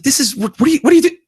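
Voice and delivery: high, loud mock protest; alarmed client. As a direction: imitate a flustered guy stammering objections.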